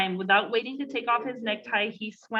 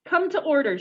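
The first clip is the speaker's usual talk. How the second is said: stern teacher; 6 semitones higher, 4 dB louder than usual. A narrator's reading. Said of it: "rapped sharply on her desk".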